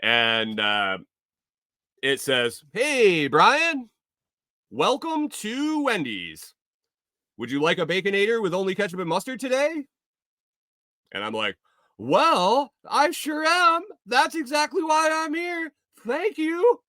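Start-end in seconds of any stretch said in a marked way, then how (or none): 2.7-9.9 high, bright-toned service robot voice; sugary AI cashier, pitched way up; chirpy drive-thru AI. Acted. AI drive-thru voice greeting him. 12.0-16.8 very high, gushing happy customer; hammed-up thrilled voice way up high; mock delighted. His own delighted reply, mocked.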